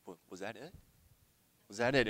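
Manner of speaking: soft, hesitant wondering to himself; tentative low-volume question; hushed questioning. Retold or imitated. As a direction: quietly puzzle over it, barely voiced. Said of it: "I kind of questioned, I was like".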